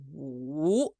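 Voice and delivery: "wu" drawn out slowly, down low then back up; dipping third tone. Shown as a demonstration. Teacher demonstrating third tone to learner, "wu" slowly dipping and rising. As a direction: trace a slow V with the pitch on "wu". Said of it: "it starts up high, goes down low".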